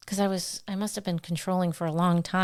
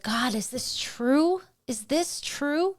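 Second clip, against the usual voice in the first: slow, higher, searching plea to God; a slow, higher, doubting prayer; retold anxious prayer; 6 semitones higher, slower than usual. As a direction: ask God slowly, pleading for an answer. Retold prayer driving home.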